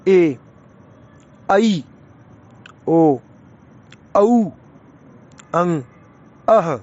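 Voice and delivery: ए, ऐ, ओ, औ said one by one, slowly, with pauses; vowel pairs demo. Shown as a demonstration. Teacher reciting the rest of the vowels for pupils. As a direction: recite each vowel clearly, the second held longer. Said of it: "चलो, इससे आगे".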